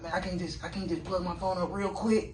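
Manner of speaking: mock pleading. Acts out someone pleading to plug phone.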